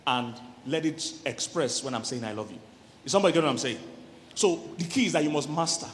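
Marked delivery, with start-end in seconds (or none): 2.2-2.6 "I love you" much lower and softer, gentle and felt; tender I love you. Shown as a demonstration. Husband telling his wife, with tender feeling. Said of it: "I can unlock the emotions".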